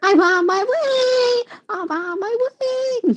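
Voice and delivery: high, slow, cracking sing-song. Pretend. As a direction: let the voice crack upward on way. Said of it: "The voice crack".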